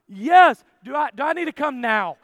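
loud, eager yes; eager acceptance. Retold or imitated. Patient accepting doctor's free surgery, eager.

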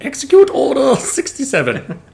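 put-on high, loud hammy old emperor; Palpatine. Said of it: "gonna go back to my Palpatine".

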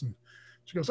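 ordinary talk, nothing marked

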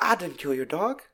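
put-on Southern innocence; Southern charm. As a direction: imitate a smooth Southern charmer. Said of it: "his southern charm".

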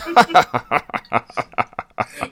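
high, forced mock laugh; gigantic fake laugh. [name] joining Jerry and Mark's laughter, gigantic and fake. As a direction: imitate a hugely overdone, fake laugh. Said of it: "this gigantic fake laugh".

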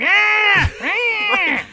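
very high, hammed-up shrieks of pain; mock pain shriek. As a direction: shriek like you're burning. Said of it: "starts shrieking in pain".

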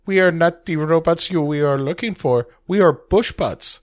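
a hint of a stiff robot. Podcast host voicing the robot Val, a light high robot voice. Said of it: "That's where Val says".

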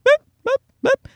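high squeaky little-voice beeps; imitating a little girl's echoing beep; high child's beep. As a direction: chirp it like a small child. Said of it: "a little voice going".

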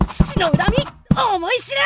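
high, fierce avenging shout; vengeful cry. Acted. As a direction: strike with the full grudge. Avenging son strikes the villain.

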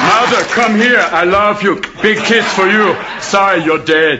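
Austrian action-hero Hamlet; Arnold Schwarzenegger. Acted. Action star as Hamlet greeting his mother, Austrian accent. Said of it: "Schwarzenegger walks into the room".

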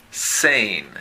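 "Sane" with a clean s; English word demo. Shown as a demonstration. Teacher reading the chart word, careful demo. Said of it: "segunda fila, primera columna".

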